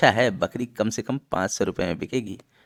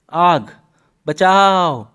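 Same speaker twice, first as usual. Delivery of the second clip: high, loud shout of alarm; panicked cry for help; 7 semitones higher, 9 dB louder, much slower than usual. Acted. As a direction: yell for rescue from a fire, voice soaring. Thief cries fire, calls for help.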